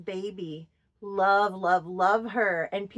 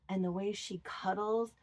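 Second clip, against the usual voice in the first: ordinary delivery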